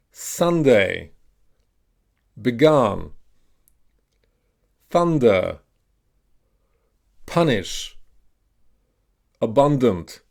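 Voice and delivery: each word alone with the vowel of "cut", as in "Sunday"; "thunder" with short U, falling tune; short U modelled. Shown as a demonstration. Teacher has learners repeat short U words, careful drill delivery. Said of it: "pronounce it as an A, as in cut".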